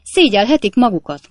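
loud, indignant rebuke; sharp landlady's telling-off; angry scolding. A narrator's reading. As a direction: scold them at full voice. Landlady scolds the lodger's guests.

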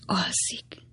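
soft, voiceless whisper; whispering to oneself. A narrator's reading. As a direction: whisper it under your breath. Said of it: "suttogta a hajadon nagynéni maga elé". (halkan maga elé suttogva)

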